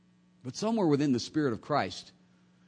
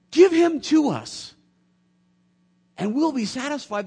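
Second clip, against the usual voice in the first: Philip pleading; 9 semitones higher, 7 dB louder than usual. Retold or imitated. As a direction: demand to see God. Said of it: "What's Philip saying?".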